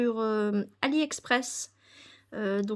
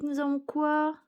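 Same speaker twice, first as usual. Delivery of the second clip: ordinary talk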